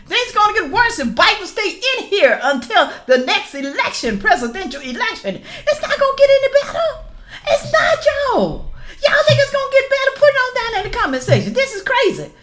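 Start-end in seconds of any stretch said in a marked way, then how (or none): 5.7-12.3 laughing disbelief. Real, not acted.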